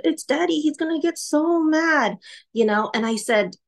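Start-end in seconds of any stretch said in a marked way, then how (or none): 0.0-2.2 put-on scared child. Child fearing father's anger.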